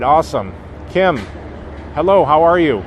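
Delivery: ordinary talk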